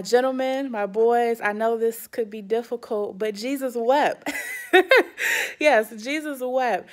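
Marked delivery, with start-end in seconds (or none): 4.4-5.6 short high laugh. Real, not acted. Laughing at "Jesus wept.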